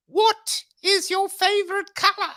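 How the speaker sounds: very high, slow sketch-comedy bridge keeper; hammed-up Monty Python gatekeeper; terrible bridge keeper accent. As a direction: draw it out in a high, silly put-on voice. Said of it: "in my terrible accent, it's, it, it's like".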